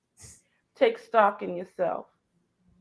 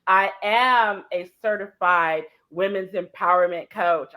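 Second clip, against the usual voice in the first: normal talking voice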